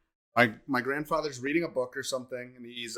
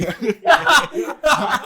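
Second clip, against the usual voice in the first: laughing; 13 semitones higher, 10 dB louder than usual. Real, not acted. Laughing at grandpa's advice.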